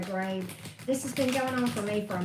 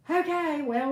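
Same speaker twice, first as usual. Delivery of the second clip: exasperated okay; 7 semitones higher, 6 dB louder, slower than usual. Real, not acted. Exasperated exclamation before reading.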